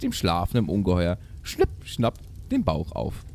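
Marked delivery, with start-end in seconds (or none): none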